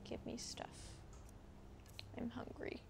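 ordinary talk

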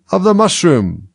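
a touch of the caterpillar. A narrator's reading. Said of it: "said the caterpillar".

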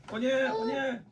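high squeaky oh no; high-pitched dismay. Real, not acted. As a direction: squeal it as the spice hits. Reacting to spicy ramen.

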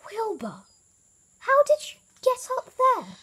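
high, loud astonished question. A narrator's reading. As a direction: call up in amazement. Elmer astonished at Wilbur.

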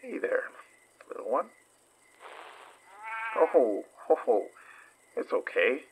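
soft, gentle coaxing; soothing a little one. Acted. Investigator soothing a little creature in the dark, softly. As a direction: coax a frightened small animal softly.

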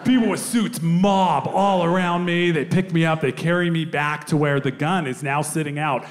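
ordinary talk

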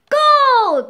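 whooping over the gold; gleeful shout. Acted. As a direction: shout it with glee. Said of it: "they shouted gleefully together".

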